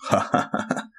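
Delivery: breathy, mocking "ha, ha"; airy laugh. A narrator's reading. The wolf mocks the second pig, hushed mocking laugh. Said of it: "i roześmiał się w głos".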